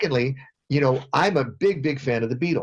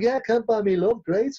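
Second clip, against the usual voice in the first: higher Liverpool lilt, light and rounded; 8 semitones higher than usual. Retold or imitated. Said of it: "He's like".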